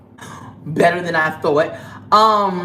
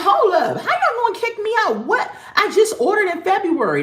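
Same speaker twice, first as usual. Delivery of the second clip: much higher, half-laughing outrage; indignant disbelief; 13 semitones higher than usual. Retold or imitated. Retelling getting kicked out to viewers, high laughing disbelief. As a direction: squeal it like you can't believe they'd dare. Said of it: "and I'm like".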